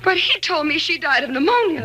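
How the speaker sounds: the shock of learning a lie; shocked disbelief. Acted. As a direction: blurt it out in disbelief. Discovering husband's lie.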